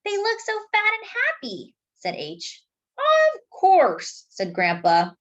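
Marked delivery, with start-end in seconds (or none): 0.0-1.7 put-on lifted high for a child; light little-kid voice. A narrator's reading. H admiring the goldfish coming home. 3.0-4.3 higher, louder jovial reply; a hearty grandpa of course. A narrator's reading. Grandpa reassuring children about goldfish.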